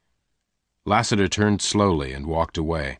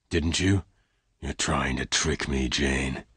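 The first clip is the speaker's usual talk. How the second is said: hard voice; 6 semitones lower, 6 dB quieter, slower than usual. Acted. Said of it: "in a hard voice".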